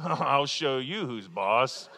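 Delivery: slow, hammed-up bossy growl; mock tough parent. A dad wrestling his kids, mock tough threat. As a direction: play the dad pretending to be the boss. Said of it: "wrestle with your kids".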